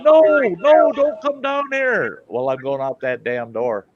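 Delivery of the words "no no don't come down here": very high, drawn-out yell; stretched high mock shout; imitated yelling. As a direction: holler a warning from a distance. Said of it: "I could hear him yelling".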